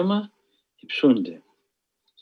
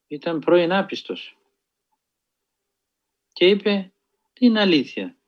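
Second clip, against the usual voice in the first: normal talking voice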